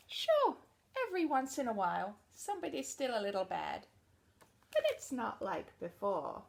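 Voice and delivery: picture book narration; reading to children. Acted. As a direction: read it out to a room of kids.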